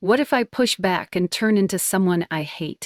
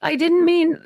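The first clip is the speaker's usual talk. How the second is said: high, louder distressed protest; sobbing protest; 11 semitones higher, 5 dB louder than usual. A narrator's reading. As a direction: plead upset, pitched way up. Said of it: "My friend's sobbing now".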